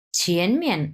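ছিয়েন rising, then মিয়েন falling sharply; second then fourth tone. Shown as a demonstration. Teacher models the full word, rise then fall. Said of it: "ছিয়েন দুই number tone এবং মিয়েন চার number tone".

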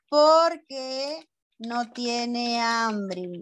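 very slow, each word drawn out; slow dictation. Shown as a demonstration. Teacher writes the answer for pupils, very slow dictation. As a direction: stretch the answer out at writing speed.

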